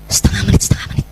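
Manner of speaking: whispered reminder. Retold or imitated. Reminding herself of the time.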